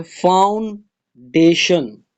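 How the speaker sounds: Foundation broken as foun, de-shun; syllable by syllable. Shown as a demonstration. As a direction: pause inside foundation after the first syllable. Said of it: "ये three syllable है".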